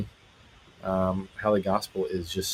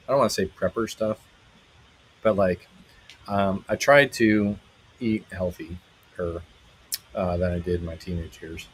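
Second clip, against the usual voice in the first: ordinary delivery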